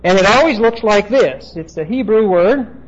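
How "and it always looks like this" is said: ordinary talk, nothing marked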